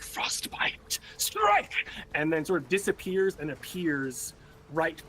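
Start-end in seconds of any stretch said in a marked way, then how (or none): 0.0-1.0 hushed whisper before the strike; whispered sword name; whispered battle cry. Pretend. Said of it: "He's like". 1.2-1.7 high, loud shout; shouted strike; battle cry. Pretend. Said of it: "He's like".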